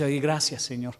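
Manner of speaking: soft, half-laughing mock thanks; a quiet little laugh of relief, lightly voiced; mock relieved thanks. Preacher voicing David relieved at Urías's death, soft laughing thanks.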